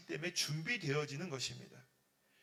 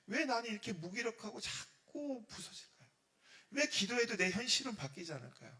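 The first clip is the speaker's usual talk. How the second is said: higher-pitched, acted lament; complaining prayer; 6 semitones higher, 3 dB louder than usual. A believer asks God why life stays hard, acted complaint. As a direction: wail the question up to God.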